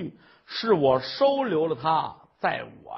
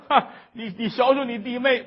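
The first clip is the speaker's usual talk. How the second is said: usual speaking voice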